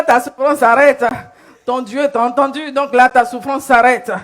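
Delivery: ordinary delivery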